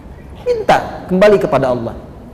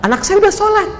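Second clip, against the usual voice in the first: very high-pitched excited voice; imitated excited mother. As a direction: play the mother announcing good news. Mother excitedly reporting her son prays. (menirukan ibu yang kegirangan)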